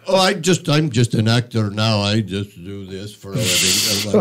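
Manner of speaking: imitating a heavy German accent, low; mock German actor voice. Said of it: "Heavy German accent".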